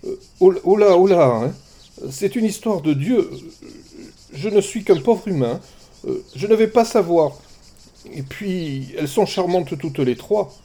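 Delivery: high, flustered stammer. Acted. Shepherd flustered before goddesses, high stammering. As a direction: fumble for a way out. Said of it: "Alexandre bredouilla".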